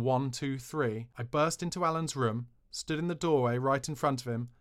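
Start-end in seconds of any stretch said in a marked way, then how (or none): none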